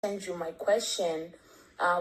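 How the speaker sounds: normal talking voice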